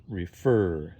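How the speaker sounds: "refer" with the weight on "fer"; stress on second syllable. Shown as a demonstration. Teacher contrasting word stress, "refer" stressed on its second syllable. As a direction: make the "fer" of "refer" stand out. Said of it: "second syllable stressed".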